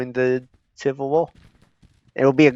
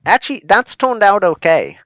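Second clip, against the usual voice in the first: surprised; 9 semitones higher, 8 dB louder than usual. Real, not acted. Battle goes well.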